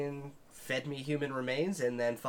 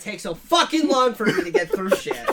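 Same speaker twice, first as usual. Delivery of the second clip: loud, high, laughing complaint; laughing exasperation; 8 semitones higher, 10 dB louder than usual. Real, not acted. Annoyed at himself for rambling, laughing loudly. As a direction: crack up at your own rambling. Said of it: "Every goddamn time".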